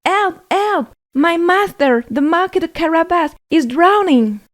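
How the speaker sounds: high, loud cry for help; frantic shouted alarm; panicked shout. A narrator's reading. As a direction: yell it out in alarm to the coachman. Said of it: "shouting to the coachman".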